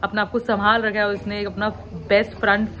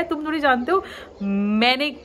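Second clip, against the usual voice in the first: ordinary delivery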